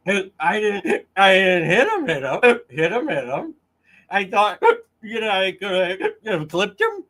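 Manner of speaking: lightly imitating her clip defense, slurring; mock drunk Karen. Host replaying her clip in a mock slurred Fall River voice. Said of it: "When they were playing the clips".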